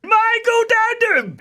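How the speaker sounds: very high squeaky warning; shrill little conscience crying out. Acted. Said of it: "a little voice said".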